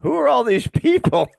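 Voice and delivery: high, loud disbelieving question; shocked question. Retold or imitated. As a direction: ask it like you can't believe your eyes. Wife baffled by the crowd.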